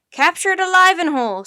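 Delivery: high, very loud order; commanding shout. Acted. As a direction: call out instructions forcefully. Professor gives urgent orders.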